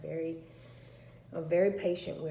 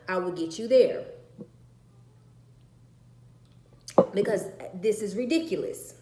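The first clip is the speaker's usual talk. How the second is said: loud, higher angry rant; furious ultimatum; 4 semitones higher, 8 dB louder than usual. Retold or imitated. Mother telling daughter to go, angry. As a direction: hurl the offer at her in anger.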